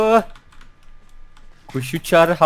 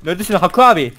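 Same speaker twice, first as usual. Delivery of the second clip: usual speaking voice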